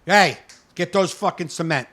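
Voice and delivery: loud, bossy barked orders; rude barking. Retold or imitated. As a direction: shout orders at the workers. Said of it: "that's how he talked to you".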